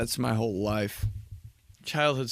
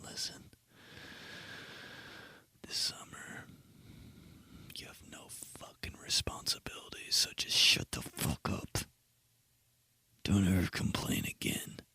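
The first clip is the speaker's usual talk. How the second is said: intense whispered warning, softer; close whisper; 5 semitones lower, 13 dB quieter than usual. Retold or imitated. Man grabbing a kid to whisper advice, softer and intense. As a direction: hiss it right into his face. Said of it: "get him real close to my face".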